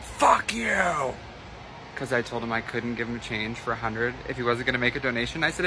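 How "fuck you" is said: a sharp, higher mock yell at the cashier; retold yell. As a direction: throw it out like an angry old man. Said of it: "just screamed".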